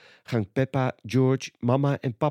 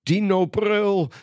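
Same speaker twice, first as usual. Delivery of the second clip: put-on higher, slow toddler voice; little George; 9 semitones higher, 4 dB louder, slower than usual. A narrator's reading. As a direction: call the toy's name fondly. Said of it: "zegt George".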